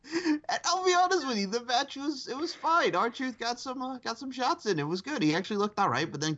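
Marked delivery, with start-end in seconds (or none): 0.1-5.2 high-pitched voice squeezed by real laughter; very high, tearful-with-laughter delivery; helpless laughter. Real, not acted. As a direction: talk on while trying to stop laughing. Still cracking up after the quote.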